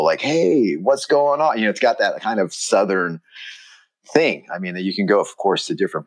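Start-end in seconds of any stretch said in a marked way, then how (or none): 0.2-1.6 high, bright eager hello; hospitable American greeting. Retold or imitated. Shop host greeting a visitor, high and eager. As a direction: greet a customer like an old friend. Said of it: "sort of that hospitable".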